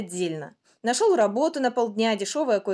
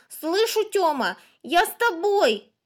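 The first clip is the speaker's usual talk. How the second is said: high, eager little-brother voice; little boy; 9 semitones higher, 5 dB louder, slower than usual. A narrator's reading. Small boy running after his older brother, childlike call. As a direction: answer high and loud, stretching the words.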